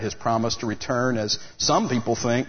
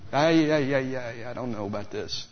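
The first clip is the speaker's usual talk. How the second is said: hesitant stammer that fades down; imitating a nervous man who stumbles over his words; 3 dB quieter than usual. Nervous disciple voiced in story.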